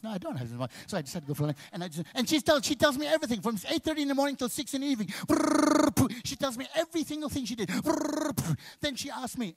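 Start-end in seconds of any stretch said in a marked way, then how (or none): 2.1-5.1 fast, high babbling rush; rapid chatter. Retold or imitated. Husband mimicking his wife's endless day story, rapid high babble. 5.1-8.5 loud high lip-trill babble; blabbering noise. Acted. Comic mimicking his wife's endless talk with loud lip-trill noises.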